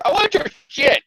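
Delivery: high mock villain voice; goblin demand. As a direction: squeak it like a cartoon goblin. Acting Hobgoblin demanding.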